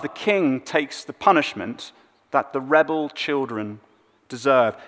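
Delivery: normal talking voice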